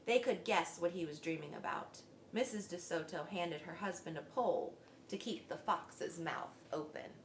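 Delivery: plain reading voice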